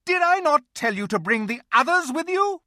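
high, loud scolding queen; angry witch. A narrator's reading. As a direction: scold him icily. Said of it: "in a terrible voice".

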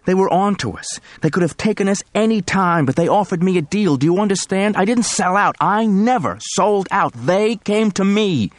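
high, vehement, slowing plea; vehement pleading. Acted. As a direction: insist desperately, begging. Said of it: "He spoke vehemently".

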